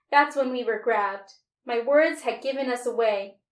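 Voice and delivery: ordinary delivery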